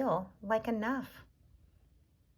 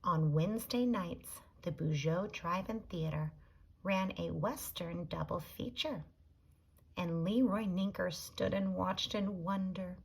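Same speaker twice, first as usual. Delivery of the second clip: ordinary delivery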